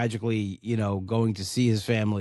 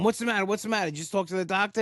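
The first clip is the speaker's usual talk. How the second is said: much higher, worried pleading retold; imitating a frightened pregnant mother, pitched up; nervous mother; 8 semitones higher, faster than usual. Pregnant mother asking her crying husband, high worried voice. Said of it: "And she's going".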